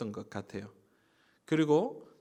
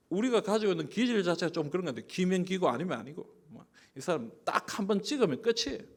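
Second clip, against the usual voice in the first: ordinary delivery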